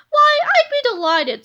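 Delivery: high, slow, thrilled hero; delighted. A narrator's reading. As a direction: gush with pleasure. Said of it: "said Captain Underpants".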